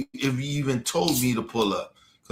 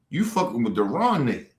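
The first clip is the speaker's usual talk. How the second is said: usual speaking voice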